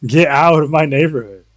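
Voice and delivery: ordinary talk, nothing marked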